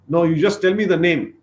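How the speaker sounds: raging elder brother demanding a name; angry demand. Acted. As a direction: thunder out who he is. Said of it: "he was very angry".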